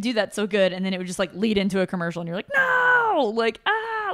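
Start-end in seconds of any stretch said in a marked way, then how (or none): 2.5-3.3 high, loud "no"; dismayed cry. Retold or imitated. Reacting to cliffhanger commercial. 3.6-4.1 frustrated groan. Retold or imitated. Reacting to cliffhanger commercial.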